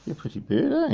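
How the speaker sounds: high sing-song pretty bird; teaching a parrot, high. Real, not acted. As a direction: lift it up high like the bird should. Coaching parrot, high take.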